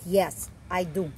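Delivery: plain reading voice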